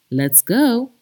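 usual speaking voice

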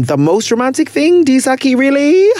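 can't believe it, rising questioning tone; incredulous. Real, not acted. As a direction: build disbelief, pitch rising to the end. Disbelieving at a romantic claim.

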